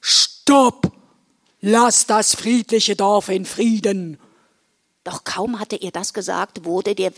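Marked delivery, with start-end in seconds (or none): 0.0-4.2 firm, forceful stop, then a steady order; Santa's commanding call. Acted. Santa leaps from sleigh at Werwolf king, commanding. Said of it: "Weihnachtsmann ... rief".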